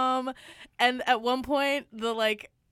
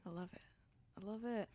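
whispered; 3 semitones lower, 19 dB quieter, much faster than usual. Pretend. Playful whisper echo.

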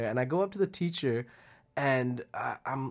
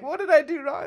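put-on worried kid; 13 semitones higher, 3 dB louder than usual. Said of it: "And I'm like".

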